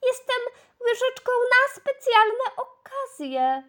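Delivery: very high thin little voice; thin tiny voice. Acted. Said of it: "zawołała cieniutko".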